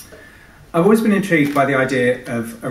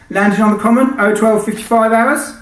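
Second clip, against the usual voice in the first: much higher and louder brash client shouting; shouty client voice; 11 semitones higher, 7 dB louder than usual. Acted. Client Sinatra shouts questions at the pilot, brash and pushy. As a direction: yell through the intercom, pushy. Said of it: "A shouter too".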